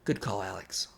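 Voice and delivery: low, hushed coach's approval; quietly commend the player, voice dropped; soft, low mock-earnest coach; solemn coach. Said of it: "the coach is like".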